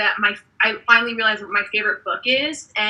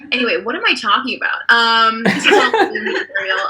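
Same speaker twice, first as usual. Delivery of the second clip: usual speaking voice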